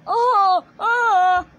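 high, loud oh and ah; cry of pain. Acted. Surgery acted out in the scene, loud high yelps. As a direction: yelp loudly in two bursts.

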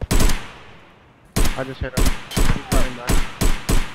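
excited callout. Real, not acted. Shooting, calling enemy movement.

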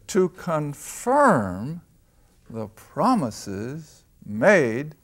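drawn-out, deliberate reread; slow, emphatic reread of the verse. Shown as a demonstration. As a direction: stretch every word out.

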